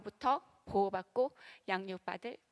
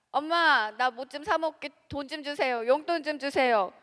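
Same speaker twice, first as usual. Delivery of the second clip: lightly voiced child asking for money; 3 semitones higher, 6 dB louder than usual.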